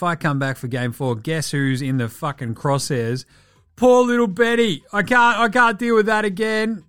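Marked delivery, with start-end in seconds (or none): 3.8-6.8 high, loud hammed-up complaint; mock wailing. Host mocking an injured player's excuses, hammed-up wail. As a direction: cry it out high in sham despair.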